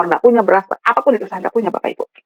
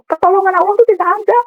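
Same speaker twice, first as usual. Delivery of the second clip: high, anguished accusation to God; retold wail of reproach; angry tearful outburst; 9 semitones higher, faster than usual. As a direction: accuse in a raised, crying pitch. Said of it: "Saya nangis, saya marah".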